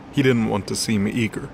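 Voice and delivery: ordinary delivery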